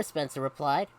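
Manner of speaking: ordinary delivery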